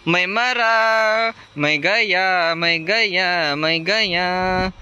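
slow, stretched mock lament, mostly at normal loudness; drawn-out dying wail. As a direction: drag out each word like a hammed-up death. Character dying in the game. (धीमा, खिंचा हुआ नाटकीय विलाप)

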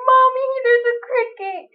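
high frightened little call to mom; scared child. Retold or imitated. Naked girl calls her mom about a cricket, frightened and pitched high.

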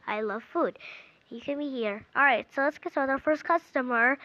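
ordinary delivery

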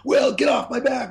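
high-pitched re-enacted yell; retold screaming. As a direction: act out screaming down the phone at a creditor. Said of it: "I'm screaming at this guy".

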